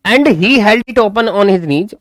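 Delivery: slow, deliberate textbook reading; slow reading aloud. Shown as a demonstration. Teacher reading the story slowly to students. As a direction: read each word out slowly for the class.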